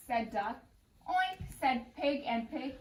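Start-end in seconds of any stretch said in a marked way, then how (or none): 1.1-1.4 pig oink. A narrator's reading. Pigs answer Duck.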